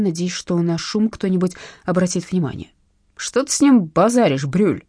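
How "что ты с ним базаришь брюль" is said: nasal thuggish voice. Acted. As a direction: speak through the nose like a thug. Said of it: "гнусавым голосом вступил". (гнусавый голос)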